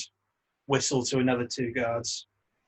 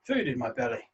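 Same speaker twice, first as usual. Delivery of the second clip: mimicking Nock; 5 dB quieter, faster than usual. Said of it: "nudges you both and goes".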